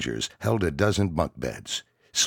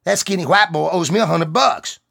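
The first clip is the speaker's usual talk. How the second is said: loud, high indignant protest; 9 dB louder than usual. Acted. Private protesting to the MP sergeant. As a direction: insist you've been wronged.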